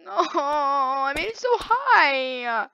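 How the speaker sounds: long, drawn-out whine; despairing wail. Real, not acted. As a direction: moan over lost progress, very slowly. Lost his progress, wailing.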